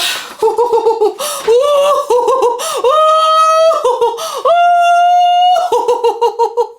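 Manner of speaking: long high laugh-cry shriek; overjoyed laughing wail. Real, not acted. Vlogger overwhelmed by her gift, shrieking laughter. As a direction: let out squealing, near-crying laughter.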